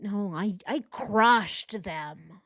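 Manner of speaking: very slow, emphatic mock bro; mocking bro correction, stretched long; Chris. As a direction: drag out CRUSHED like a bragging bro. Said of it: "Who talks like this?".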